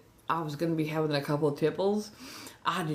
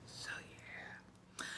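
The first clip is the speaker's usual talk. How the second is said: whispering; 22 dB quieter than usual. Real, not acted. Trailing aside.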